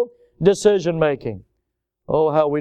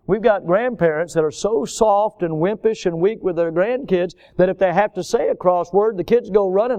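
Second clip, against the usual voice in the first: ordinary talk, nothing marked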